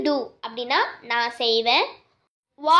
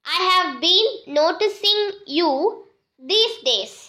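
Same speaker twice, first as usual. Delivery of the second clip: word-by-word reading for learners; slow model sentence; 3 semitones higher, much slower than usual. Shown as a demonstration. Teacher models the sentence for students, slow. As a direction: read it out slowly and clearly. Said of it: "இப்போலாம் நான் உன்னை கவனிச்சுட்டு தான் இருக்கேன்".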